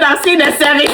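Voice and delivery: high, laughing through the words; delighted laughing delivery; laughing quote. Real, not acted. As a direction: giggle the good news. Quoting midwife while laughing.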